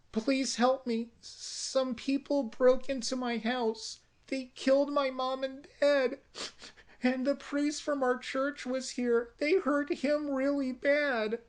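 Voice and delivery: put-on high, sweet, innocent little girl. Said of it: "Tony's voice is very sweet, innocent".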